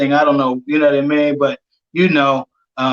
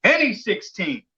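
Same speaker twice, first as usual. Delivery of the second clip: high-pitched call-out at no extra volume; slow, drawn-out mock holler; retold yell; 3 semitones higher, 5 dB quieter, slower than usual. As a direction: imitate yelling across the gym, held back. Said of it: "I yell out at this point".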